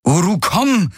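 high, uncontrolled command; losing patience. A narrator's reading. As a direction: order the animal over, out of patience. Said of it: "wiederholte sie unbeherrscht".

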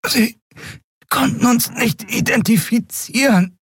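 high, strangled voice; halting, suffocated explanation; choked out. A narrator's reading. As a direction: force the words through a squeezed throat. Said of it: "brachte sie erstickt hervor".

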